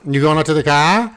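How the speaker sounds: put-on playful Boston-accented child voice; kids' New England accent. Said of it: "New England or a Boston or a Maine or a New York accent".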